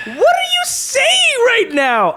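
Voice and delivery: very high, slow disbelieving squeal; incredulous. Real, not acted. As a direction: stretch it out in outraged disbelief. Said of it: "shocked at cohost".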